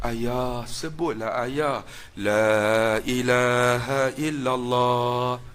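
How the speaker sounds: soft, slow, pleading whisper-close voice; mournful tone. Retold or imitated. Son urging dying father to say the creed, softly mournful. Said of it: "dia buat nada sayu".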